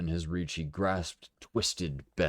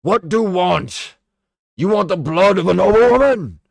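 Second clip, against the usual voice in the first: taunting bellow; 17 dB louder than usual. A narrator's reading. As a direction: roar it over the crowd. Bodden taunts the mob.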